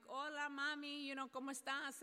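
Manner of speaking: warm greeting. Retold or imitated. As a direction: greet her like family. Greeting crying mother in Spanish.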